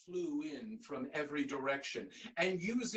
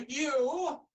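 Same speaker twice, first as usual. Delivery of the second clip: loud, high royal call; booming king; 9 semitones higher, 9 dB louder than usual. Acted. King calls out to the ragged man, booming. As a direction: boom it out like a king.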